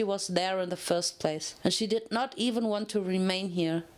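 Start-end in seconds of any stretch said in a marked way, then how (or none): none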